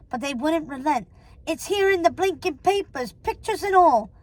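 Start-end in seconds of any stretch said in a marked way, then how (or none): 1.5-4.1 higher-pitched crook's complaint; agitated, high henchman voice; protesting henchman. Acted. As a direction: whine that the news is out.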